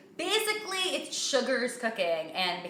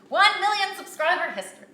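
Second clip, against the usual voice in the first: excited boast; 11 semitones higher, 8 dB louder than usual. Real, not acted. Proud milestone announcement.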